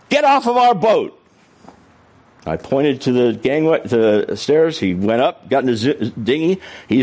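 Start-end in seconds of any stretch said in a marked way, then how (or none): 0.1-1.2 raised, higher command to leave; mock stern eviction, lightly raised; firm order. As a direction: order him off the boat, pitched up. Ordering bully off the boat.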